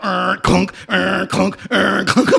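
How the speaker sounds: playful bump noises; imitated car bumps. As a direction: act out the car dropping down each step. Car bouncing down steps.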